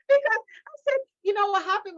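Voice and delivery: high, loud, through laughter; tickled by your own comeback; laughing while talking. Real, not acted. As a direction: barely get it out for laughing. Cracking up while retelling her comeback.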